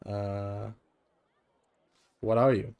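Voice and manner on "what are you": usual speaking voice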